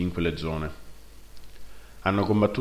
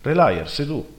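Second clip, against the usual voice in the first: surprised; 7 semitones higher, 4 dB louder than usual. Acted. Brother's surprised recognition.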